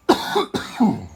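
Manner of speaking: a rough cough in the pirate voice. A narrator's reading. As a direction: clear the throat like a sea dog. Pirate coughs after hailing.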